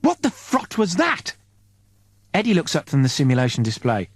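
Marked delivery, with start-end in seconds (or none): 0.0-1.4 higher, slow startled question; rising alarm at the blast; Oslo's startled cry. Acted. Oslo reacting to the explosion, high and slow. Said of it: "Oslo yells".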